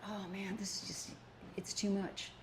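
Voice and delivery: imitating her own tired, whispered giving-up; weary whisper. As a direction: sigh it out as if worn down. Retelling own discouraged moment.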